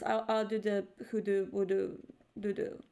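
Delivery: ordinary talk, nothing marked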